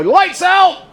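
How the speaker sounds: high, loud imitated dad yell; authoritarian father's yell. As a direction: call it out like a strict father. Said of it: "authoritarian father would yell".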